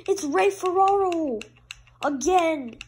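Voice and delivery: high, loud, drawn-out name call; astonished announcer call. Real, not acted. Gamer spots Ray Ferraro fighting again, astonished shout.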